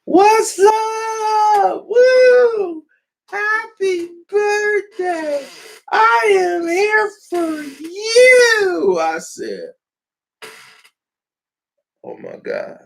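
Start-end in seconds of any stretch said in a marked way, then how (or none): 0.0-9.0 put-on drunk party girl. Wasted friend crashing his birthday party, squealing high and slow. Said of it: "And she's like".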